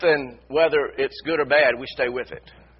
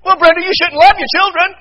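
mock rebuttal; 13 semitones higher, 11 dB louder than usual.

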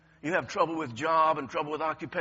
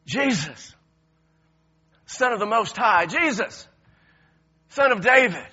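loud, high desperate plea; crying out; 5 semitones higher, 8 dB louder than usual. Acted. Blind beggar crying out loud to Jesus for mercy. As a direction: call out to Jesus with your whole soul. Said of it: "your heart and your soul and your spirit cried out to him".

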